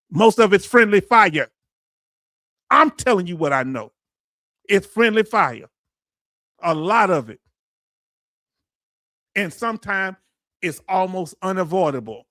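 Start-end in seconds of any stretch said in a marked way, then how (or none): 0.1-7.4 higher, louder, pressing the point home; emphatic loud insistence. Real, not acted. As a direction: insist hard that it's friendly fire. Insisting on friendly fire truth.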